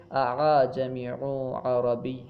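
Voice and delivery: "اعجمی عربی" slowly, second hamza eased into a glide; softened hamza (tashil). Shown as a demonstration. Teacher gives a tashil example, "اعجمی عربی" with eased hamza. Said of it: "تسہیل کا معنی ہے نرمی کرنا".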